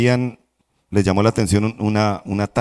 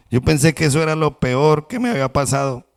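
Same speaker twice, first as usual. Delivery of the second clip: ordinary delivery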